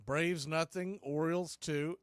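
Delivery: ordinary delivery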